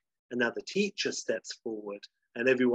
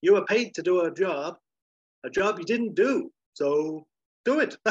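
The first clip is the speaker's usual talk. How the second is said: higher, louder, declaiming voice; emphatic acted declaration; 6 semitones higher, 6 dB louder than usual. He tells him to do the paid job, emphatic. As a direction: lay down the law, pitched up. Character's indignant declaration in retelling.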